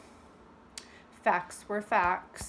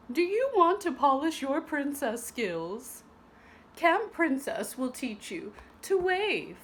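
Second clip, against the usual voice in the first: bright, lively letter reading, slightly higher; letter read aloud; 4 semitones higher than usual. A narrator's reading. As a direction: read it like a cheerful invitation.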